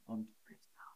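breathy whisper, no voice; whispered demo. Shown as a demonstration. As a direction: whisper it to show what flüstern means. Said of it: "flüstern ist leise sprechen". (geflüstert vorgeführt)

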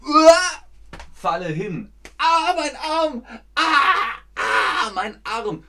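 high, loud hammed-up howls of pain; mock pain cries. Teacher acts falling off a scooter, moaning in pain. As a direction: cry out as if the arm hurts.

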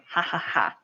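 low, spelled-out ha-ha-ha; mock laugh. Shown as a demonstration. Teacher illustrating "yo me río" for learners, mock laugh. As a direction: fake a laugh for the example.

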